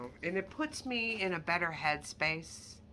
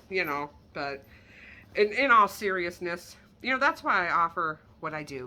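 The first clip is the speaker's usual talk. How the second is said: usual speaking voice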